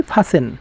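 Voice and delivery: ফাসেন softly, the t silent; quiet model ফাসেন without any t; correct pronunciation. Shown as a demonstration. Teacher repeating the answer, ফাসেন with silent t. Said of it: "উচ্চারণ হবে কি?".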